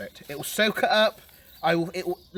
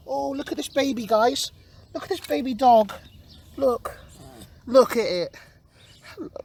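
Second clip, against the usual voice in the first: excited cooing; 4 semitones higher, 4 dB louder than usual. Real, not acted. Cooing at a baby dog.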